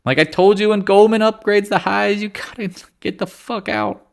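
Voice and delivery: high mock voice of an angry follower; mocking a critic. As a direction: squeak it out like a heckler. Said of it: "Every day I get somebody going".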